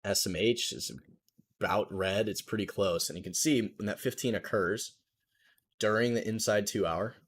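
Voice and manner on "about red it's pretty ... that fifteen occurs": ordinary talk, nothing marked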